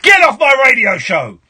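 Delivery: loud, high demo bawling; shouty shock jock. Shown as a demonstration. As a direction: throw the caller off in full shock-jock mode. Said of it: "do you prefer it when I do this".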